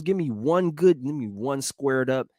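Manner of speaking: ordinary delivery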